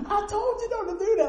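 high-pitched mom scolding; an exasperated mother's told-you-so. Retold or imitated. Mom scolds her son on the floor in a high voice.

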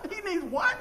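high-pitched, incredulous chuckle; retold amused astonishment; laughing disbelief. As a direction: question it through a laugh. Retells disbelief at wife's claim.

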